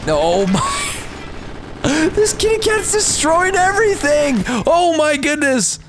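very high, squealing laugh-talk; amazed squeal. Real, not acted. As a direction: squeal in delighted disbelief. Amazed at destruction.

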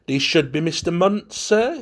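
a hesitant servant, lifting it as a question; doubtful. A narrator's reading. Butler to the young men, doubtfully. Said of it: "he said doubtfully".

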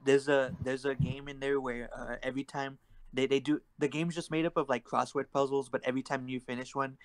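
ordinary talk, nothing marked

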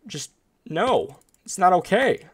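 high-pitched aggrieved complaint; indignant protest. Real, not acted. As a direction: protest it, voice swooping up. Upset at losing investment.

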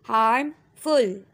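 हार्मफुल drawn out slowly as one word; slow blended word. Shown as a demonstration. Teacher blending syllables, slow careful read. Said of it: "second part फुल".

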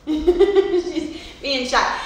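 laughing while talking. Real, not acted. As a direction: laugh through the words. Laughing at shy daughter.

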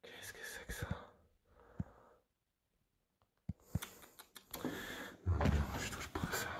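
wary hushed whisper; voiceless refusal. Real, not acted. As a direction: whisper and back away from it. Hushed in a dark house.

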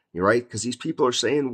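ordinary talk, nothing marked

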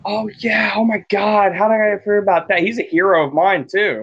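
excited fan gushing; real excitement. Real, not acted. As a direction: burst out with delighted recognition. Remembering a shared local hero.

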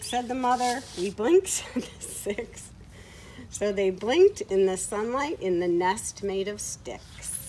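lively, sing-song storybook reading; reading to children. Acted. Reading a farm story aloud to children at home, lively storybook voice. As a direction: read it brightly to little ones.

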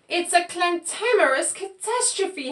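high mock howl of dismay. A narrator's reading. Pig sees he is dyed all over, howling. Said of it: "howled Pig".

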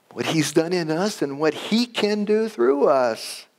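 high, emphatic sermon delivery; impassioned preaching. Real, not acted. Preacher urging the congregation to rely on his working, impassioned. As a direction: preach it with rising fervour.

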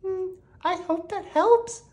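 very high, small character voice; high-voiced storybook lady; doctor turned firefighter. A narrator's reading. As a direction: offer help in a squeaky high voice.

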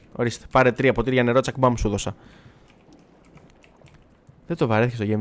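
normal talking voice

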